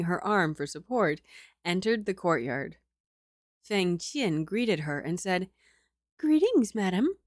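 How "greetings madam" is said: high, bright maid greeting; maid's voice. Acted. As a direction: greet the lady politely.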